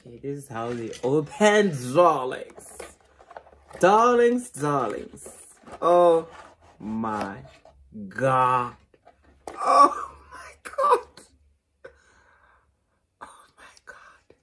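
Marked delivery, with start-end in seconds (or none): 1.0-5.3 mock diva. Diva address to viewers. 5.8-11.0 overwhelmed gasp. Real, not acted. Vlogger overwhelmed opening the gift, near tears. 13.2-14.4 breathless whisper, barely voiced; awed whisper. Real, not acted. Vlogger awed by the gift, whispering.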